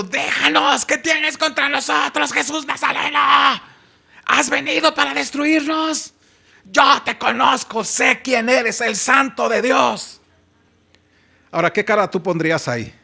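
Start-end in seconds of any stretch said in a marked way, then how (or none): 0.0-10.2 high, screechy possessed voice; screaming demon. Acted. Possessed man confronting Jesus in the synagogue, high screeching cry. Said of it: "él empieza a gritar esto".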